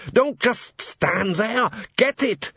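high, urgent command; panicked order. A narrator's reading. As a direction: yell at them to act. Boss orders workers in panic.

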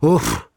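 short breathy grunt on landing; winded oof. A narrator's reading. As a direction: let out an "oof" as if landing hard. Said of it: "sat down hard and said".

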